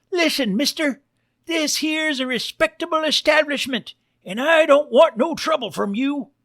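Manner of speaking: high-pitched cartoon Western bartender; barkeep pig. Bartender warns an armed stranger off, high cartoon pig voice. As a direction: pitch it way up as a folksy saloon pig.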